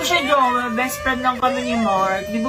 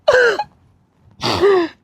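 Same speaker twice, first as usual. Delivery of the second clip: hammed-up crying; 6 semitones higher, 3 dB louder than usual. Daughter begging boyfriend on phone, hammed-up sobbing. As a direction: wail it like a melodramatic breakup. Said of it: "anong iniiyak-iyak mo dyan".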